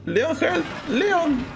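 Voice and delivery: high-pitched cry for Leon; calling for help. Pretend. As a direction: call out high to a game companion for help. Calls game companion for help. (llamada de auxilio en tono agudo)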